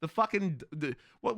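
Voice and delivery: usual speaking voice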